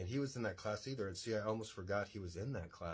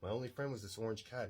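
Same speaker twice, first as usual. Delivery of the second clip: normal talking voice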